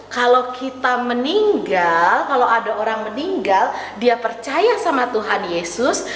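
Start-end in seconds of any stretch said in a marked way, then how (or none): none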